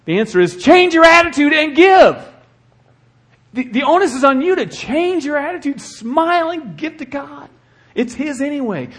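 high, loud preaching climb; preacher exhorting the church to smile and give, loud and high; preaching hard. Real, not acted. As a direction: lift it up and drive it home. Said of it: "preacher exhorting congregation".

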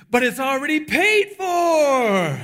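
jubilant holler, stretched long on the last words; excited shout. Retold or imitated. Cashier announcing to customers their food is free, excited shout. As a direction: belt it out slowly like big news.

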